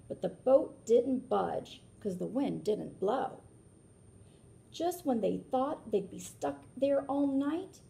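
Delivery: lilting read-aloud rhyme; storybook narration. A narrator's reading. Reading a rhyming book aloud to children, lilting. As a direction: read it like a picture book for kids.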